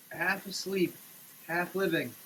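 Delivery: ordinary delivery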